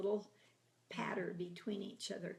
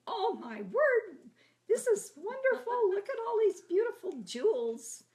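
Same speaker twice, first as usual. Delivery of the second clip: much higher, louder squeal of fake wonder; mock delight; 13 semitones higher, 11 dB louder than usual. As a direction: sister playing along with the prank in a high, thrilled voice.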